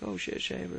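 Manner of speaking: high, startled greeting; surprised. Retold or imitated. Student spotting his buddy at the office, high surprised blurt. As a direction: blurt it out in mock alarm.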